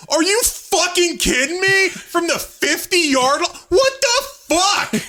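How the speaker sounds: high-pitched mock outrage, slow at the end; retold outburst. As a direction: shout it way up high in disbelief. Retold reaction to laughing friends.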